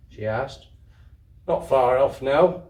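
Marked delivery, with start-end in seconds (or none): 1.5-2.6 a hint of a louder working man; gruff storeman. Storeman reassuring the sleepy child, a touch louder. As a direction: answer kindly, man to child. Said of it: "answered the storeman".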